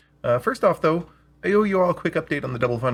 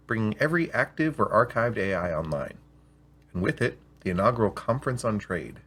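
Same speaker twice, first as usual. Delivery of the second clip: measured storyteller reading; unhurried read-aloud narration; calm audiobook storytelling; story narration; 4 semitones lower than usual. A narrator's reading.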